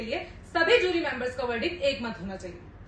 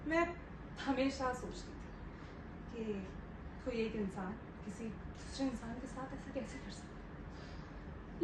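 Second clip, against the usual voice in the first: slow, much softer and lower; quiet, pained reflection; 5 semitones lower, 13 dB quieter than usual. Acted. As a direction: drop to a hushed, pondering voice. (धीमी, दबी आवाज़ में सोचते हुए)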